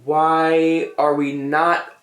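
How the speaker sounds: usual speaking voice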